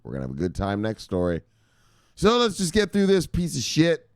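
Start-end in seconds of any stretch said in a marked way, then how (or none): 2.1-4.1 high, exasperated outburst; pissed off. Real, not acted. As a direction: burst out, fed up with the story. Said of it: "I'm pissed off about this story".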